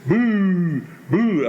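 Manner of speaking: loud, high crowd boo; loud mock boo. Singer recalling the crowd he thought was booing, loud. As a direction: shout it like the whole house. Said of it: "the only thing I could hear is".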